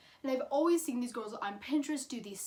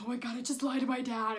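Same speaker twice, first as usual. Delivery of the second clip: freaking out; faster than usual. Retold or imitated. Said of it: "I'm here freaking out".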